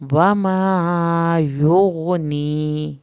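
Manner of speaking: slow, drawn-out tajweed chant; Quranic recitation. Shown as a demonstration. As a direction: recite each word slowly, melodically. Recites verse.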